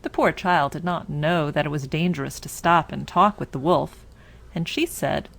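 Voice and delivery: ordinary delivery, nothing marked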